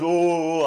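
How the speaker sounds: imitated barber noises. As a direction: mimic his wordless oohing. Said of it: "he makes sounds at me".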